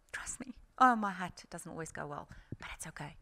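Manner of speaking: very soft whispered aside; confiding whisper. Real, not acted. Speaker admitting to audience it doesn't always go well, whispered. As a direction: drop right down to a whisper, confiding. Confiding that things can go badly.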